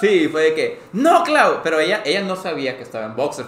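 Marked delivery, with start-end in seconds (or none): 0.9-1.6 negativa aguda y firme; un no cortante, ya harto; negativa harta. Retold or imitated. Se niega, harto. (niega a Clau con un grito agudo)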